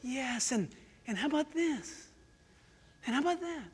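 hushed, insinuating devil's suggestion; sly tempting Satan. Acted. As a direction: slip the idea in very softly. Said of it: "Satan goes".

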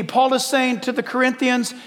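ordinary talk

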